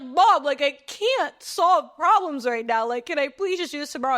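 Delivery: high, loud retold whine; overwhelmed pleading. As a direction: plead with mom, exasperated.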